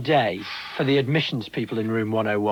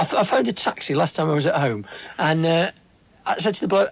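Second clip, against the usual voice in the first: ordinary talk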